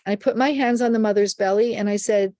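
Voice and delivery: ordinary talk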